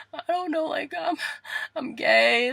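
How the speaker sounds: high, squeaky sobbing voice; mock crying. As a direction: blubber it out in exaggerated tears. Re-enacts crying confession to aunt.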